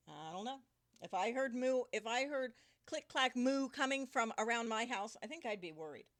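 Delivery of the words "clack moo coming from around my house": ordinary delivery, nothing marked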